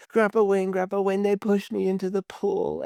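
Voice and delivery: put-on high pleading little-boy voice; a small boy wailing to his grandpa; crying child. Little boy crying to grandpa for help. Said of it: "comes over crying".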